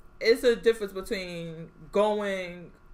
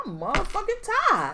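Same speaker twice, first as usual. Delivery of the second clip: put-on higher kid's deadpan complaint; kid rapper quote; 9 semitones higher than usual. Quoting the rapper's kid to her friend, high kid voice. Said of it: "she was like".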